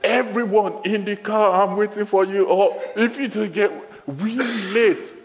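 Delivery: high, loud, laughing harried-parent shouts; mock frantic yelling. Preacher replays yelling at family on Sunday morning, hammed-up loud shouting. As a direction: bark at the family to hurry into the car. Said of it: "my way of going".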